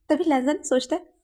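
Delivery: usual speaking voice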